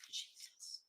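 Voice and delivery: a whispered, exasperated jesus under the breath. Real, not acted. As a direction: breathe it out in disbelief, barely voiced. Exasperated aside at the writing.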